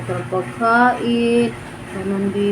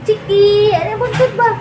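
retold call; 10 semitones higher, 3 dB louder than usual. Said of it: "sabi ko".

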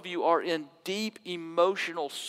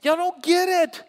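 a lightly voiced Jesus, raised and urgent; voicing Jesus; 6 semitones higher than usual. Jesus pleading with the crowd, lightly voiced and raised. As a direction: preach Jesus' words high and pressing.

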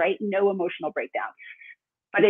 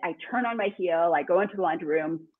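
normal talking voice

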